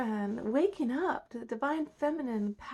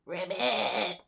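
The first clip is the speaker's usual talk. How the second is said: imitated frog ribbit; little repeated ribbits; frog croak; 5 dB louder, much slower than usual. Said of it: "his horrible little chirping sounds".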